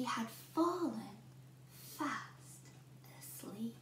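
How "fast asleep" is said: soft sleepy whisper; hush, she's sleeping. A narrator's reading. Narrator whispering so as not to wake Goldilocks.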